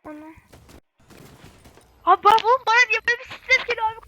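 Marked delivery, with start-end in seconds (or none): 2.0-4.1 panicked. Real, not acted. Sudden panic during a game.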